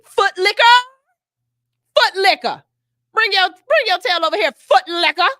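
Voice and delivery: loud, high scolding yell; mock yell at her kid. Mother yelling at her kid to come over, loud scolding call. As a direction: yell across the room at the child.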